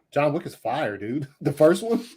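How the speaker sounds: usual speaking voice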